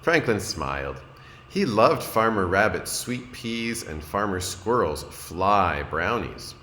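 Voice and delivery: ordinary delivery, nothing marked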